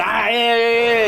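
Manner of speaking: high-pitched mock laughing along; imitated laughing. Storyteller imitating two men laughing about a woman, mock hilarity. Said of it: "they're laughing about it".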